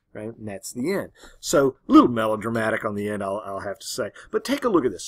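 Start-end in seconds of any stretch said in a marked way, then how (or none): none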